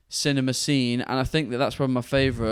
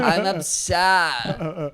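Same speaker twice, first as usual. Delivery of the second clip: slow, higher put-on moan; mock sulky voice, stretched slow; hammed-up upset whine; 4 semitones higher, much slower than usual.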